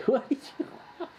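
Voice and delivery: quick, high, laughing question; laughing at the dog. Real, not acted. Host laughs as the dog nudges him, high and fast. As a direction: giggle it out to the pet.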